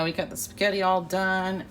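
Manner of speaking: ordinary talk, nothing marked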